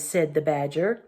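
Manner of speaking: plain reading voice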